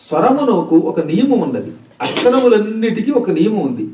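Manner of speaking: usual speaking voice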